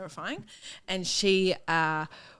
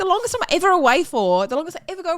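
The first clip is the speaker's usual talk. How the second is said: mock outrage; 6 semitones higher, 4 dB louder than usual. Furious comeback to colleague.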